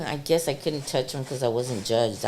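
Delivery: ordinary talk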